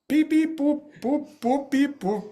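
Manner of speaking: high robot bleeps; high-pitched, staccato beep-boop imitation. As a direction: mimic a droid trying to talk in beeps. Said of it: "R two D two".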